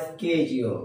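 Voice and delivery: schedule said "ske-jul"; low, slow schedule with "skej"; American pronunciation. Shown as a demonstration. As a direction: start schedule with a hard sk. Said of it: "American প্রনাউন্সিয়েশনকে".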